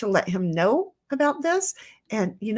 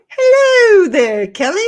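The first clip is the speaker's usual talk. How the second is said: high, loud, stretched-out greeting; calling out hello; 10 semitones higher, 12 dB louder than usual. Retold or imitated. Reading a chat greeting to Kelly, calling out hello. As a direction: sing out a big drawn hello.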